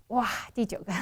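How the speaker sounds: ordinary delivery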